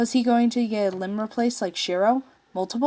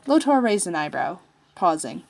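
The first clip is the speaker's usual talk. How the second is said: plain reading voice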